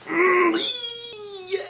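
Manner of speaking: high whine drawn out slowly; mock whimper. Kid dreading the spider on him, whimpering. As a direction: whimper and squeal in acted dread.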